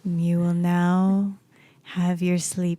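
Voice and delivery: very slow, drawn-out hypnotic monotone; mock sleep hypnotist. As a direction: stretch each word like a pretend hypnotist.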